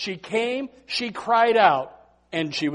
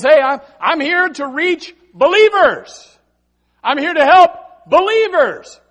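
loud, high preacher's declaration; emphatic proclamation; 9 semitones higher, 11 dB louder than usual. Retold or imitated. Preacher voices Jesus refusing a pleading mother, loud emphatic proclamation. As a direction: proclaim it forcefully, drawing out key words.

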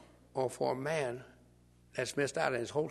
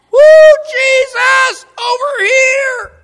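very high, very loud and drawn-out yell; frantic shout; 24 dB louder, much slower than usual. Pretend. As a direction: holler over the crowd, stretching it out. Blind man shouting over crowd.

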